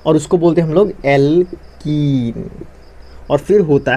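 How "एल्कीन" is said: Alkene in two slow, stretched parts; drawn-out key term. Shown as a demonstration. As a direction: teach alkene slowly, holding the long "keen".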